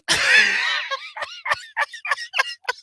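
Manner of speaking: squealing laugh; high shrieking laugh. Real, not acted. Laughing after catchphrase.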